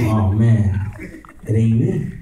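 low resigned grumble to himself; dejected self-talk. Retold or imitated. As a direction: sink down, mildly crestfallen. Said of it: "So, yo me puse".

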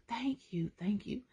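soft unvoiced whisper; whispered prayer. Real, not acted. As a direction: breathe the thanks to God.

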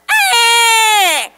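put-on a sudden shrill yelp of a toddler's tantrum; child's wail. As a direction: let out a short, loud, high wail. Child throwing a fit.

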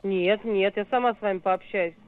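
ordinary delivery

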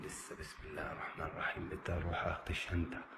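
ordinary delivery

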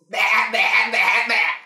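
loud bleating goat sound; goat bleats. Acted. Storyteller imitates the goats, a loud bleat. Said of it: "close to the goats".

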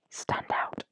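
a hushed whispered punchline. Acted. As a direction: drop to a sudden whisper for effect. Dramatic aside on bright button.

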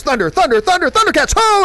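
rising chant to a high, loud shout; cartoon hero's war cry, climbing high on the final word; rallying battle cry. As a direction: build thunder by thunder into a heroic call. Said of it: "he just goes".

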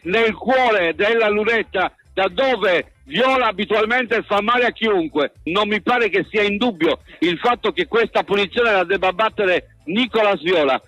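ordinary talk, nothing marked

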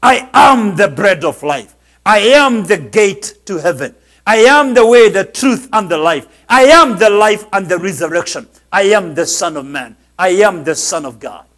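loud, proclaiming "am" with full force; Jesus proclaiming who he is, boldly and loudly; bold declaration. Acted. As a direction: declare it like a king with no apology. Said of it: "with no apologies, spoke like this".

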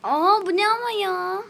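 high, loud shocked cry; surprised outburst. Real, not acted. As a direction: blurt it out in shock. Sudden obstacle in game.

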